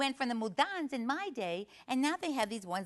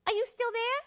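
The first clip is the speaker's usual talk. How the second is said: put-on high, louder small-kid question; little child's voice; 13 semitones higher, 5 dB louder than usual. Child calling to the stranger in the next stall, high and curious. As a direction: call out like a curious four-year-old. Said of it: "the voice says".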